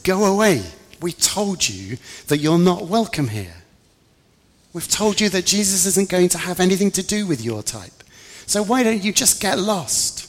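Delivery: loud, high-pitched scolding; angry dismissal. Acted. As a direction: butt in and drive her off. John butts in, orders her away.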